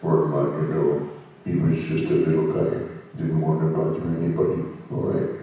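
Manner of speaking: low, soft voice of a timid little creature; Wormmon. Shown as a demonstration. Voice actor showing fans the shy little-guy voice, low and soft. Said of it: "I'll start with the voice first".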